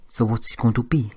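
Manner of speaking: put-on suave French-accented question. A narrator's reading. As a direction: lightly challenge her in a French accent. Pierre, the accented Frenchman, speaks.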